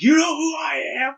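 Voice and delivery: high furious shout; freaking out. Retold or imitated. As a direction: lose it at the commoner. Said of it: "losing his shit, like, freaking out".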